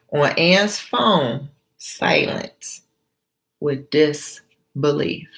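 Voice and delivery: normal talking voice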